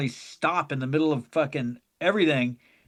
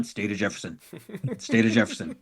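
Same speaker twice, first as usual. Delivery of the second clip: deep mock announcer; 8 semitones lower, 5 dB quieter than usual. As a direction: boom it into the mic deadpan. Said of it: "And you kept going".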